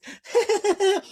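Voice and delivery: high-pitched silly giggle; squeaky mock giggle. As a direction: giggle in the put-on voice. Mocking giggle after acted plea.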